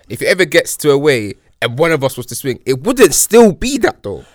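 usual speaking voice

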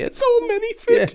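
high slow prissy voice; dainty posh voice. Pretend. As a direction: play it like a fussy tea host. Mock dainty voice.

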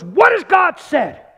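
emphatic question. Real, not acted. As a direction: ring it out like a challenge. Preacher quoting the true question.

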